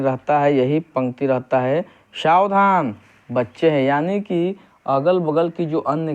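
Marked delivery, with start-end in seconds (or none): 2.1-3.8 "सावधान" set apart as a warning, then "बच्चे हैं"; warning "सावधान", short pause, "बच्चे हैं"; pause after सावधान. Shown as a demonstration. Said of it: "सावधान किया जा रहा है".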